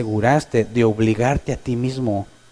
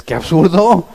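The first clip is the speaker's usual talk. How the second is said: mock outcry; 4 semitones higher, 8 dB louder, slower than usual. Mock exclamation.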